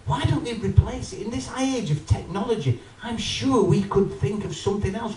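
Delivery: ordinary talk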